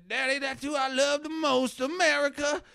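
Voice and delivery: high-pitched child imitation; little boy voice. Boy on the tractor tells daddy his love, high child voice. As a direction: pitch it way up as an adoring kid.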